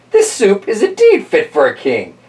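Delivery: put-on high old lady proclaiming; proud like a little old woman; high, grand old lady. Old lady voiced in story.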